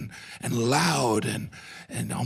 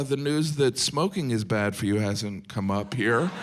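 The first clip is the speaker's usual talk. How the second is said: usual speaking voice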